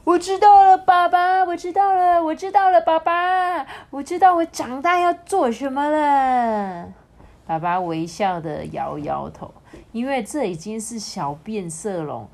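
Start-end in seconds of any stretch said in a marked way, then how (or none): 0.1-6.9 put-on high, loud, excited child's shout; little chameleon yells to dad, thrilled; shouting excitedly. A narrator's reading. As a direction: burst out with the big news, shouting. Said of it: "喘气大叫说".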